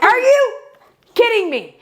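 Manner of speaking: high, imitated exasperated yell; loud outburst. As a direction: mimic an incredulous driver's outburst. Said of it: "Like, loud".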